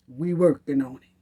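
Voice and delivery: low sarcastic brush-off; mocking officials. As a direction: mumble the excuse lazily. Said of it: "exactly what it's giving".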